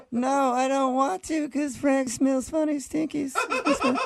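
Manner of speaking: high, drawn-out mocking imitation; high silly put-on refusal; mock caller voice. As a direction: whine it slowly up high as the caller. Mimicking the woman who hung up.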